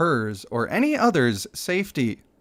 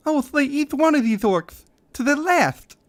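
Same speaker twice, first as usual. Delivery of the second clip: lisping Reza voice; 10 semitones higher than usual. Acted. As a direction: vow vengeance in a high lisp. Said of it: "get the lisp out".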